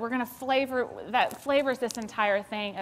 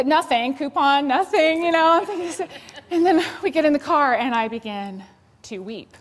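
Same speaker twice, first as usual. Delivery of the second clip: usual speaking voice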